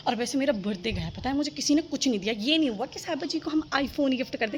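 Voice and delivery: ordinary delivery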